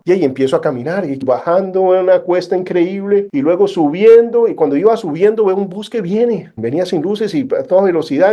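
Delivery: normal talking voice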